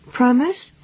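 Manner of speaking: put-on high coaxing promise, like to a child; mild motherly prompting rise; talking to a child. A narrator's reading. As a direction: coax him like a mother with a kid. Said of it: "same way that she dealt with her children".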